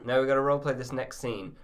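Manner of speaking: ordinary talk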